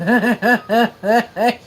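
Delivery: loud laughing burst; real laugh. Real, not acted. Laughing at the owner who wouldn't book shows.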